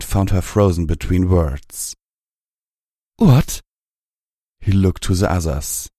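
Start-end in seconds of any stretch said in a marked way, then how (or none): 3.2-3.6 high, sharp burst of surprise; startled. A narrator's reading. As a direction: jolt out the word. Terado startled by freeze.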